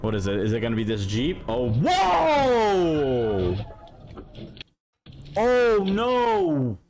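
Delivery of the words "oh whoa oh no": loud, drawn-out high cry of shock; shocked reaction. Real, not acted. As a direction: wail it out as the crash hits. Reacts live to crash clip.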